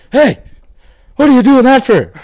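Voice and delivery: loud, high mock yell of an old East Coast fella; angry old man. Telling off a guy who cut past him, loud high mock yell. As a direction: belt it out like an indignant old passenger.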